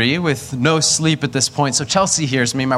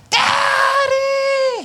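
put-on child's wail. Child calling daddy from the bedroom at night, high wail. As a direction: stretch it out like a sick kid calling from bed. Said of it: "always said in this certain way".

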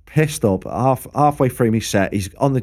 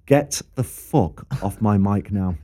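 slow, low, controlled anger; retold angry order; 4 semitones lower than usual. Singer grabbing back his mic from a drunk, slow angry order. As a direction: spell out the warning slowly and lower.

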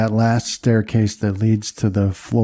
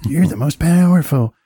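much higher, gushing flunky voice; fawning toady; 8 semitones higher, 3 dB louder than usual. Acted. Toady minion praising the beholder's power, gushing and high. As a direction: gush high praise at your master. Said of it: "some toady saying".

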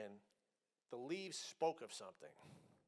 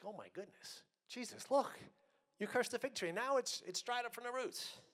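high, amazed exclamation; a disciple gasping in amazement at his teacher; astonished Peter; 9 semitones higher, 4 dB louder than usual. Acted. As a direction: play Peter stunned by the withered tree. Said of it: "Peter's astonished and like".

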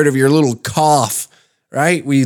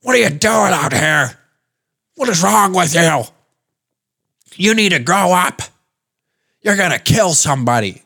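high, strained scolding shouts; mock outraged yelling; 7 semitones higher than usual. Panicked public scolding someone sick outside, mock outraged yelling. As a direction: berate them in exaggerated outrage. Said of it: "They start yelling things".

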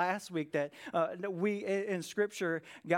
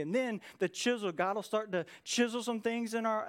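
usual speaking voice

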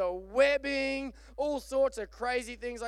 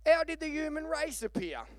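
put-on high-pitched little English girl; English accent; 7 semitones higher than usual. Little girl asks her mother, in a high English accent. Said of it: "evidently the little girl was English".

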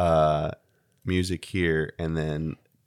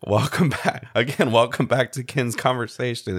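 upbeat louder show opener; podcast intro; 5 semitones higher, 5 dB louder than usual. Acted. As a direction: announce it like a radio host. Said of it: "fade into me saying this".

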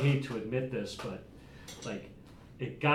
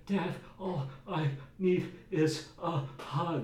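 a lightly voiced small boy, higher and slow, pleading; imitating crying son; 7 semitones higher, slower than usual. Father retelling his crying son's plea, mock child voice. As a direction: beg like a tearful little kid for a hug. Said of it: "sometimes would be crying".

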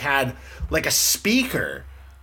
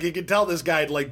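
normal talking voice

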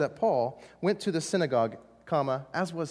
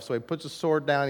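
ordinary delivery

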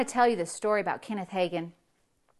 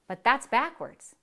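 usual speaking voice

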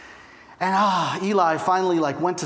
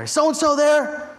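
mock angry caller; 6 semitones higher than usual. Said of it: "Like, real angry and upset".

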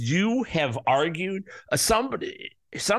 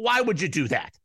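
normal talking voice

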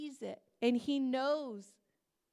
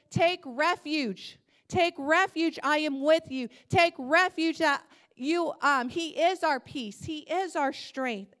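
God commanding; 3 semitones higher, 8 dB louder than usual. Pretend. God answering the believer, loud proclamation. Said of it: "And he says".

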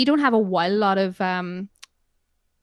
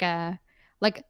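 normal talking voice

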